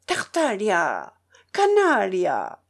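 slow, drawn-out cry at the dog; old man calling out. A narrator's reading. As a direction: cry it out, stretching each word. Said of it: "lui cria le vieillard". (cri traînant de vieillard)

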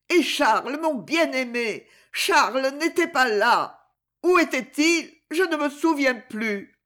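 anguished lament. A narrator's reading. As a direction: relive an old terror with mounting sorrow. Madwoman relives her terror, in tears.

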